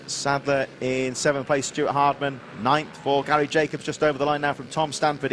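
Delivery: usual speaking voice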